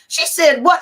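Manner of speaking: imitating scandalized listeners, high and loud; shocked disbelief. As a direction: blurt it out, aghast.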